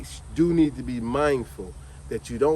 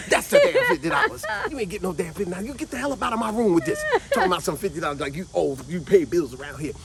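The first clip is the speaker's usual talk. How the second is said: angry scolding; 2 semitones higher than usual. Retold or imitated. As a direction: blow up at the kid over the money. Aunt scolding nephew over money.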